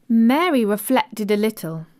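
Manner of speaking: ordinary delivery, nothing marked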